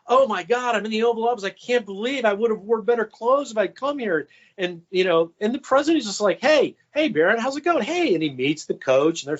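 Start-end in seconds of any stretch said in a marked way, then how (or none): 0.1-4.3 mock starstruck gush, higher. Starstruck coach in Oval Office. 6.4-8.1 imitating a cheery dad, much higher; cheery greeting. President greets son cheerily.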